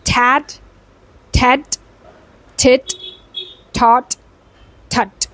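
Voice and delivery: isolated words with crisp T at both ends; word-by-word T practice; plosive T drill. Shown as a demonstration. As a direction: tap out "Tat, tet, tit, tot, tut" one by one. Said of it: "So for the T sounds".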